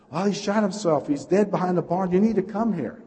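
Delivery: high-pitched frantic report; retold distress call, urgent and pleading; imitated panic. Church lady reporting a suicide to pastor, imitated panic. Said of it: "she is just really panicking".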